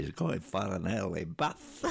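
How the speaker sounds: laughing northern English accent imitation; northern English accent through a chuckle, aping a commentator; light northern English commentator accent; Eddie Waring northern accent. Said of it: "He got the accent".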